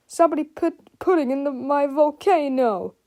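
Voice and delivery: high whiny complaint; Freddie. A narrator's reading. Freddie finds pudding in his volcano, whining. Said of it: "whined Freddie".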